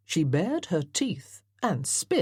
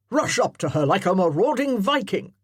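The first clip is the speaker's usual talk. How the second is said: loud parade-ground colonel; commanding colonel; 3 semitones higher, 6 dB louder than usual. Acted. Colonel directing his amateur actors, loudly commanding. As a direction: bark orders like a drill officer.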